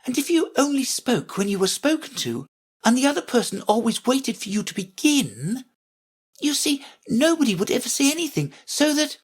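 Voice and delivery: put-on very high, reasoning girl; argumentative Alice. A narrator's reading. Alice arguing with the Queen, high and insistent. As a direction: argue on in a high voice until cut off. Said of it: "always ready for a little argument".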